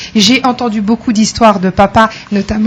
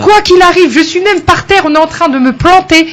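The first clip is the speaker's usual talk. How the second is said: fiery insistence; 7 semitones higher, 3 dB louder than usual. Real, not acted. Speaker urging the young audience, fiery and loud. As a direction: preach the positive attitude hard. Guest dismissing negative attitudes.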